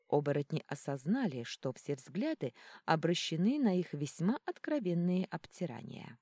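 usual speaking voice

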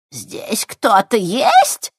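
slow, high, displeased crone calling out; displeased old woman. Acted. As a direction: call out slowly, annoyed and suspicious. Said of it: "недовольно повторила хозяйка". (недовольная старуха окликает)

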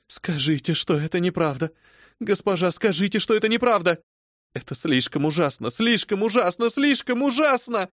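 high, rising anguished begging; desperate pleading. Acted. Phoebe on her knees begging her mistress to deny it.

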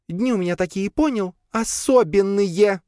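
high, irate character voice; snarling back. A narrator's reading. Lea explains her bad days to the cat, snarling. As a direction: snarl it high, stretching out the last word. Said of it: "зарычала в ответ Лея".

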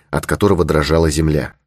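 ordinary delivery, nothing marked

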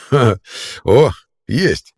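loud satisfied guffaw; gleeful chuckle. Acted. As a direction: bark a pleased laugh. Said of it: "довольно хохотнул".

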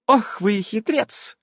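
high, slow, admiring lilt; delighted teasing. A narrator's reading. Nasa admires the player's cunning, high and delighted. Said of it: "с восторженными интонациями в голосе".

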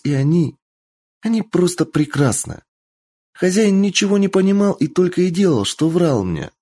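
put-on high earnest young fox-girl; kitsune girl voice. A narrator's reading. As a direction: tell her story, high and heartfelt.

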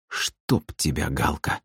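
slow, soft resigned curse; muttered curse. A narrator's reading. Brother giving up on his sister, soft muttered curse. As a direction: curse softly and slowly, giving up.

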